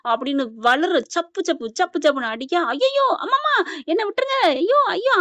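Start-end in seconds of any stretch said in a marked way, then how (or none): 2.7-5.1 screaming from a nightmare. Retold or imitated. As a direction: plead and wail as if being beaten. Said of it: "அம்மான்னு கத்திட்டு எந்திரிச்சா". (கனவில் அடி வாங்கி அலறுவது போல நடிப்பு)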